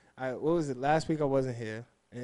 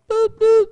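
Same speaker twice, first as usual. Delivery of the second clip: playful boop sign-off; 13 dB louder than usual. Acted. Sign-off sound effect.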